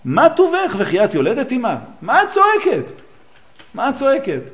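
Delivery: high, loud mock call of the neighbours; neighbours' mock scolding. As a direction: play the nosy neighbours shouting up the stairs, half-hammed. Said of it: "והיו שכנותיה אומרות לה".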